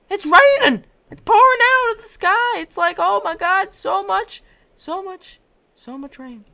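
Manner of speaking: high, loud complaining shout; exasperated yelling. Real, not acted. Snowboarder yelling to viewers about the pouring rain, loud. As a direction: yell it at the sky.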